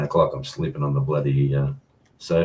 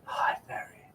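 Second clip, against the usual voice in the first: low breathy whisper; 9 dB quieter than usual. Shown as a demonstration. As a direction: drop to a hushed whisper. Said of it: "Gotta get lower".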